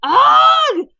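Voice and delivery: very high, shouted groan of disgust; loud disgusted cry. A narrator's reading. Hiccup seeing mouse sick on the pillow, disgusted yell. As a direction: cry out in high-pitched revulsion. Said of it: "said Hiccup".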